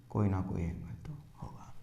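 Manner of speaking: breathy whispered aside; hushed repeat. Shown as a demonstration. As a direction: drop to a whisper for emphasis.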